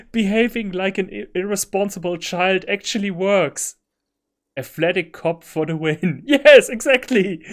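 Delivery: high, loud delighted laughing; gleeful. Real, not acted. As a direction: celebrate giddily.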